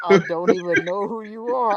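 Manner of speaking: lightly voiced villain, slow; a hint of a supervillain, drawn out; Thanos. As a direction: deliver it as a slow, deliberate movie-villain quote. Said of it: "Thanos line".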